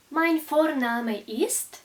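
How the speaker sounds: ordinary talk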